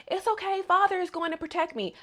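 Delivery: put-on high, small child's voice, trusting; little girl voice. Little Mio reassuring the servant, high childlike voice. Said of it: "little Mio is just like".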